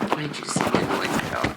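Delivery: hushed, voiceless aside; barely voiced murmur; whispering. Real, not acted. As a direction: whisper it under your breath. Muttering low to someone nearby.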